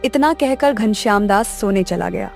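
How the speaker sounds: ordinary delivery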